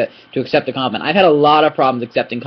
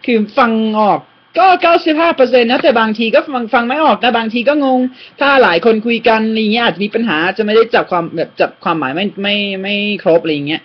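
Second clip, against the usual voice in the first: candid Thai self-assessment; 7 semitones higher, much faster than usual. Retold or imitated. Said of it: "they say".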